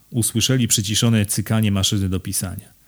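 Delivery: ordinary delivery, nothing marked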